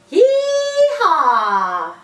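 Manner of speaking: high, loud yee-haw shout; cowboy cheer. Shown as a demonstration. Teacher demos cowboy cheer to class: whooping yee-haw. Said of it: "How about a cowboy cheer".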